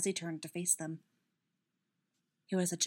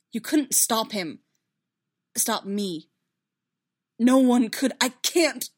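loud protest rising in pitch and force; desperate; 4 semitones higher, 10 dB louder, slower than usual. A narrator's reading. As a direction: build from insistence to desperation. Distraught character loses control.

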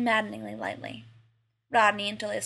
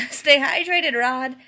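louder, higher parting shout; yelled; 4 semitones higher, 9 dB louder, slower than usual. A narrator's reading. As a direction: call it out the car window. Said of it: "poked his head out the window and yelled".

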